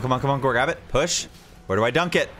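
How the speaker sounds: ordinary talk, nothing marked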